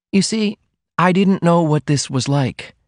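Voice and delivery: put-on high-pitched young woman in love; confiding heroine. A narrator's reading. Heroine explains her feeling.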